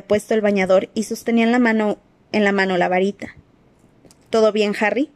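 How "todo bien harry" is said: ordinary delivery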